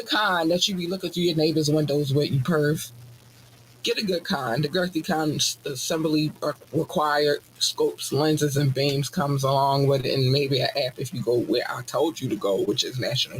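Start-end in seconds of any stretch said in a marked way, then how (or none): none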